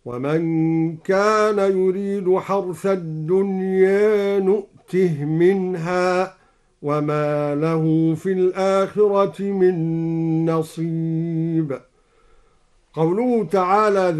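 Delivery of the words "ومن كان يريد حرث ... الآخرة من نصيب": slow recitation, نؤته closed on a vowelless h; إسكان الهاء. Shown as a demonstration. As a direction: chant slowly, stopping نؤته on "tih", no long vowel. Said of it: "قرأ الدوري بإسكان الهاء هكذا". (ترتيل بإسكان هاء نؤته)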